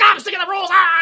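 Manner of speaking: high, loud acted yell; fed-up outburst. Boss's writer sick of the rules, acted shout. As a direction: explode in mock exasperation, ending in a yell.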